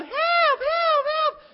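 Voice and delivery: high, loud mock cries for help; retold yelling. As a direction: cry out like kids calling for rescue. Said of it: "So we were yelling".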